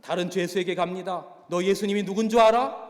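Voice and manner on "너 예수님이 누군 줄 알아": much louder and higher, a rough quiz question; retold prisoner loudly quizzing a fellow inmate; loud challenge. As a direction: fire the question at the other prisoner. Go Jae-bong quizzes another prisoner.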